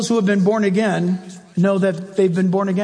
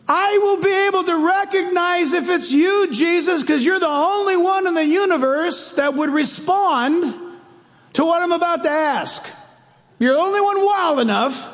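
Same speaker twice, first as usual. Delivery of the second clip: calling out; 9 semitones higher than usual. Retold or imitated. Peter calls out to Jesus across the stormy water. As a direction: shout to Jesus over the wind.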